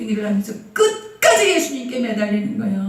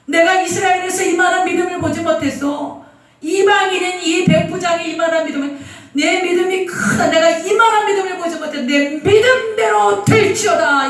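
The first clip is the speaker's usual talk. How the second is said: Jesus exclaiming in high-pitched delight; joyful; 6 semitones higher than usual. Acted. Jesus praising the centurion's faith, higher and overjoyed. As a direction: lift it up with joy and wonder. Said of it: "얼마나 기뻐하셨는지 몰라요".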